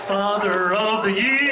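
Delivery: slow, stretched-out proclamation; long-held final word; mock announcement. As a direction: drag out each word like a title award. Mock self-congratulation, drawn out.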